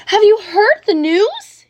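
high, loud, slow excited call; crying out excitedly. A narrator's reading. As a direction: burst in waving the newspaper. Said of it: "he cried".

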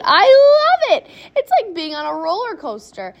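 high, loud thrilled yell, easing off; excited shout. A narrator's reading. Boy shouts to Grandpa on a wild ride, excited. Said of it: "Charlie shouted".

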